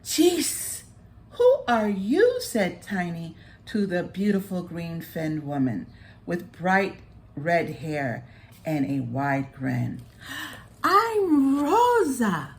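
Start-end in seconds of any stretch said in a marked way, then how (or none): 0.0-2.4 high and louder, taken aback; butterfly startled by the mermaid. Acted. 10.8-12.5 high, slow and loud introduction; grand mermaid. Acted.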